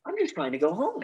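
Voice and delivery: high, earnest plea; pleading madman. Retold or imitated. Gunman telling the hostages he just wants home, high plea. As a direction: beg to be let go home. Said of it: "he's like".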